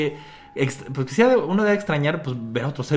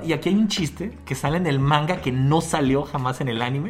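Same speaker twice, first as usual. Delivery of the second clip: ordinary talk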